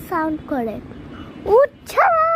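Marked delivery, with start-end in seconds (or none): 1.6-2.3 high, loud indignant cry; indignant outburst. Real, not acted. Complaining to viewers that mom doesn't wake, loud outburst. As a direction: burst out at the unfairness. Kid protests mom not waking.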